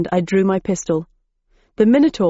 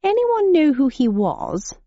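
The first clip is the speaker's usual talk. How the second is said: higher, slow, breezy English gent; posh gentleman; 8 semitones higher, slower than usual. A narrator's reading. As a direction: ask the room idly. Gentleman asking around.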